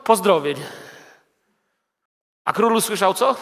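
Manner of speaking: ordinary talk, nothing marked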